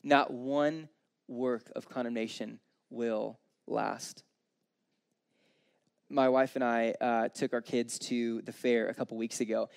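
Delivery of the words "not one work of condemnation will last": slow, weighted words with pauses; solemn emphasis. Real, not acted. Preacher closing his point to the congregation, slow solemn emphasis. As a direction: land each word deliberately and softly.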